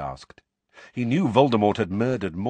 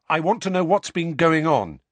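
urgent; 9 semitones higher, 7 dB louder than usual. A narrator's reading. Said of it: "Harry said at once".

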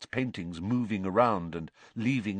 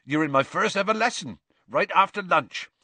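higher, keen announcement; bubbling with eagerness about the lesson; eager; 8 semitones higher, 4 dB louder than usual. A narrator's reading. Hagrid tells pupils about his first ever lesson, eager. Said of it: "he said eagerly".

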